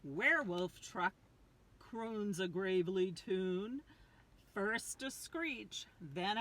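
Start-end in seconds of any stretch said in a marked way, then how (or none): none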